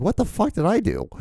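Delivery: high-pitched, indignant bewilderment; baffled protest. Retold or imitated. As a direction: protest your innocence, pitch shooting way up. Retelling reaction to being told off.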